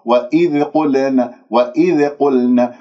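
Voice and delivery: "وإذ قلنا" with an echoing bounce on ذ and ل; slow "وإذ قلنا", ذ and ل popped with qalqalah; wrongly bounced letters. Shown as a demonstration. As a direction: demonstrate the mistake: tiny vowel release after ذ and ل in "وإذ قلنا". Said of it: "أنا أقلقل الذال وأقلقل اللام من كلمة قلنا".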